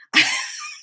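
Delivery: high burst of real laughter; high-pitched giggle; amused laugh. Real, not acted. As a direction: laugh at your own story. Laughs at her own dream.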